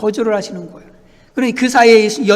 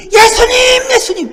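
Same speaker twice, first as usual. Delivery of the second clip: high-pitched pleading call; crying out; 14 semitones higher, 6 dB louder than usual. Acted. Woman shouting after Jesus as he walks on, crying out. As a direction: call out as if to someone walking away. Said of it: "계속 소리 지르는 거예요".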